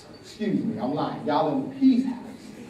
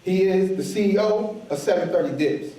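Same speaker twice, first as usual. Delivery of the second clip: usual speaking voice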